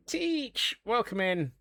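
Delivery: high, ringing call of Teach. Real, not acted. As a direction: sing out the greeting. Greeting a chat viewer.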